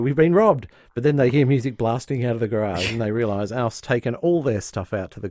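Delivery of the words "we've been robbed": loud panicked announcement; mock shocked couple; alarmed. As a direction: blurt it out in alarm. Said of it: "just go".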